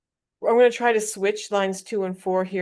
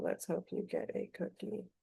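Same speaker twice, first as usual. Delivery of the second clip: whispering the line to herself; barely voiced, trying out the rhyme; whispered draft; 12 dB quieter than usual. Real, not acted. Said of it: "whispering draft lines to herself".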